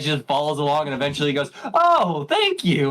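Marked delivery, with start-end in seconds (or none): 1.7-2.9 high-pitched gushing girlfriend impression; mock squeal of gratitude; imitated delighted thanks. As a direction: swoop way up, playfully thankful.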